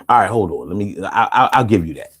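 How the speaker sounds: ordinary talk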